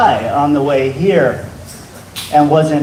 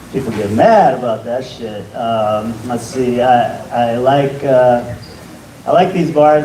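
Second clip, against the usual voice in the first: ordinary talk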